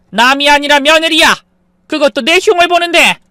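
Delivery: very high, loud protest; outraged shout. Acted. Mother to son about being badmouthed, outraged shout. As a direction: hurl back your grievance. Mother defends overhearing phone call.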